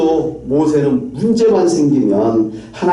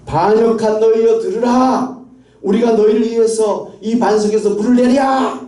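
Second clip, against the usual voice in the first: high, declaimed scolding of a crowd; indignant outburst in a raised pitch; angry rebuke; 8 semitones higher, 3 dB louder than usual. Retold or imitated. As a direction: act out Moses berating the people. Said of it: "화를 내면서".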